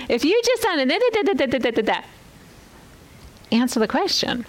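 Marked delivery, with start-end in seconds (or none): 0.1-2.0 breezy high la-di-da filler; high sing-song da da. Shown as a demonstration. Teacher mocking readers who skip questions, high sing-song. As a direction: skip over the words in a high singsong.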